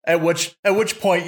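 ordinary talk, nothing marked

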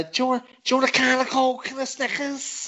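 high put-on voice offering a snack; Ike impression. Co-host repeats the shopkeeper's line in a put-on high voice. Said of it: "they say".

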